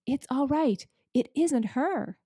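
excited call. Acted. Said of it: "beavers talk when they are excited".

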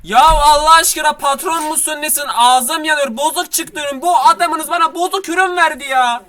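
high-pitched angry protest; outraged complaint. Acted. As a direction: complain hotly to the boss. Customer complains to the boss.

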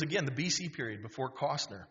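ordinary talk

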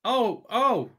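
usual speaking voice

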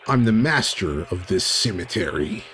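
cemetery master. Acted. Graveyard master warning intruders off the undead, ominous.

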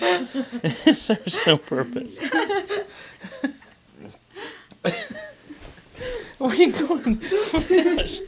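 high, breathless helpless laughter; being tickled. Real, not acted. Daughter tickling mom, laughing fits.

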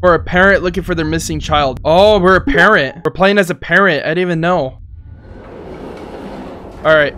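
normal talking voice

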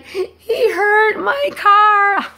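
high, drawn-out lament; exaggerated high whine of dismay; mock wail. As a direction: wail it slowly, hammed-up and childlike. Acts out her wail over car.

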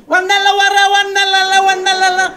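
loud gibberish, pitched moderately high; mimicked old man. Older man with a cane bringing the answers, loud mock holler. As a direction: bellow the nonsense as a caricature elder. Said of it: "אחד מבוגר אתיופי כזה".

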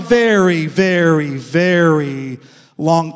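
very, very, very stretched slow and low; drawn-out emphasis. Real, not acted. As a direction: drag out each very to show the years. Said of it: "drawn-out emphasis on very".

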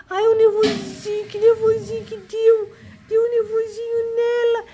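high, sing-song pet teasing. Real, not acted. Grandma teases her worked-up kitty, high baby talk. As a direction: coo high at a worked-up little cat.

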